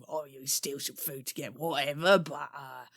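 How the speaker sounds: normal talking voice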